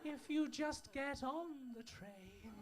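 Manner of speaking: slow, soft calming voice; soothing plea. Acted. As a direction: talk the crowd down gently. Calming tannoy-style plea.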